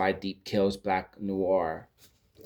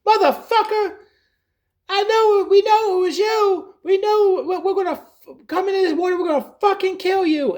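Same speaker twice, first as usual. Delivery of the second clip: much higher and louder furious screaming, laughing through it; Samuel Jackson shark rage; 7 dB louder than usual. Retold or imitated. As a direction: scream the threat like a raging cartoon shark. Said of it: "it's voiced by Samuel Jackson".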